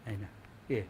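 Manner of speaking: ordinary talk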